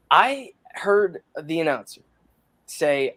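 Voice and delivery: ordinary talk, nothing marked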